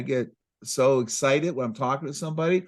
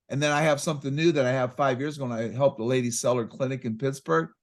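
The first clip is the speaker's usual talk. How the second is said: usual speaking voice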